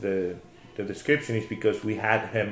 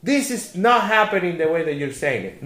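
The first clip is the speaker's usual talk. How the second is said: high-pitched, loud imitation of disbelief; incredulous protest; 10 semitones higher, 5 dB louder than usual. Drunk patient insisting somebody's paying, incredulous. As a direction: mimic a flabbergasted man refusing to believe it. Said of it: "he said to me".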